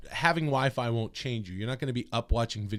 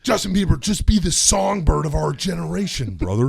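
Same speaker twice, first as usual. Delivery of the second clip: Hulk Hogan voice; 3 semitones higher, 7 dB louder than usual. Shown as a demonstration.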